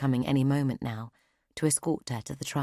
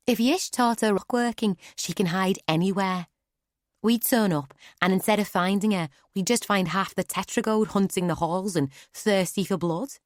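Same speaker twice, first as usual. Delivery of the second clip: higher, louder, rushed persuasion; eager Clara; 5 semitones higher, 4 dB louder than usual. Acted. Clara convincing Nonna, eager rushed higher voice. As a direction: sell the idea fast and keen.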